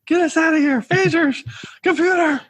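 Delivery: very high-pitched panicked yelps; hammed-up, high frantic cries for help; Nog freaking out. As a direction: imitate a character in a squealing panic. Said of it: "where he is freaking out".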